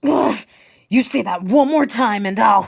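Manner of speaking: loud, cut-off snarl of a threat; angry threat. Acted. Sister threatening her brother before he cuts her off, angry and loud. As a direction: warn him through rising anger.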